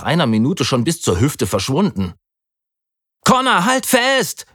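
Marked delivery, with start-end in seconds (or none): 3.2-4.5 high, slow urgent shout; panicked shout. A narrator's reading. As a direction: yell for him to hold on. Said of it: "schrie ich panisch".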